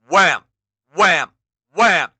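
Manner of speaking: loud punchy wham; wham effects. A narrator's reading. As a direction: slam each one. Narrated impact effects.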